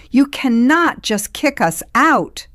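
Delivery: high, loud shriek. A narrator's reading. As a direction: shriek it in shock and panic. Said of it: "she shrieked".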